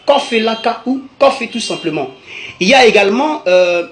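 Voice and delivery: usual speaking voice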